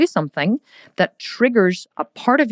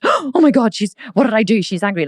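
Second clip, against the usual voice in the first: higher, louder sudden panic that fades to worry; child's panic; 5 semitones higher, 3 dB louder than usual. Retold or imitated. Child's brain panicking at an angry mum. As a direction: gasp and panic like a scared kid. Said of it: "your brain goes".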